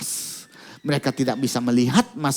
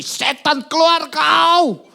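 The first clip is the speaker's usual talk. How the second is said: much higher, louder and slow commanding shout; casting out shout; 13 semitones higher, 6 dB louder, much slower than usual. Retold or imitated. Father commands the spirit to leave the girl, slow commanding shout. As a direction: drag out the order like an exorcist.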